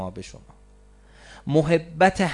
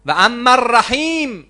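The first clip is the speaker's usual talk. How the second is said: slow, much higher and louder call; drawn-out higher pulpit cry; proclaimed; 11 semitones higher, 12 dB louder than usual. Real, not acted. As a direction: ring out و اما الرحیم from the pulpit. Preacher announces the next name.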